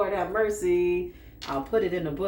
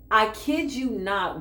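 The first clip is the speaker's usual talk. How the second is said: usual speaking voice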